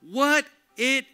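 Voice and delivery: loud, high scripture reading; full-force preaching. A narrator's reading. Preacher reads Israel's outcry to the congregation, loud and impassioned. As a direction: belt out the people's outcry like a preacher.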